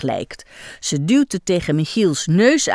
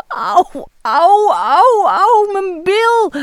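higher, louder, drawn-out blubbering; mock crying; 11 semitones higher, 5 dB louder, much slower than usual. A narrator's reading. Michiel cries over his sore bottom, hammed-up wailing. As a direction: wail like a hurt child. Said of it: "huilt hij".

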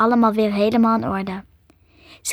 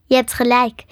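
ordinary delivery

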